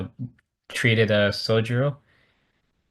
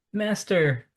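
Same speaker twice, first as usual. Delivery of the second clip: higher, ringing call of the master; emotional cry; 9 semitones higher than usual. Retold or imitated. Kenshin leaping toward his master, higher emotional cry. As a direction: cry out to him, overcome. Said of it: "Kenshin starts to get all emotional".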